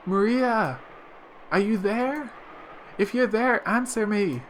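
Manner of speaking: high, loud calls into the wind; calling out. Acted. Mother calling for her daughter in the storm, loud and high. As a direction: shout for her missing child over the storm.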